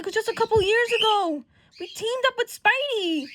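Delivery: high, loud protest; insistent recollection. Acted. Johnny recalling teaming up with Spidey, loud high insistence.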